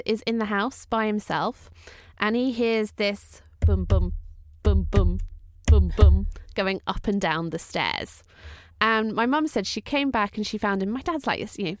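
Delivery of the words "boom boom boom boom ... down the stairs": slow, heavy booms, a little louder; imitated booms. Telling the ghost story, thumping out the footsteps slowly. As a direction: thud out each boom like a footstep.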